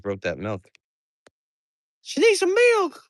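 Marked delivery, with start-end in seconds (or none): 2.0-3.0 put-on high cooing baby talk to the goat. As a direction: coo it high like to a baby animal.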